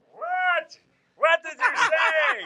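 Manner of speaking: cupping an ear, straining to hear; hard of hearing. Retold or imitated. Hard-of-hearing husband mock.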